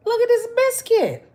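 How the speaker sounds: high thrilled squeal; excited squeal. Real, not acted. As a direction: squeal with delight at the biscuit. Showing off the biscuit.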